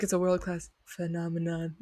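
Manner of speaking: mock a friend's accidental impression, softly; understated imitation of a pompous politician; Trump. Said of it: "You kinda sounded like Trump".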